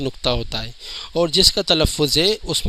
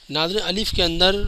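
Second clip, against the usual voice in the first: usual speaking voice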